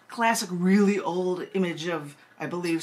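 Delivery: ordinary talk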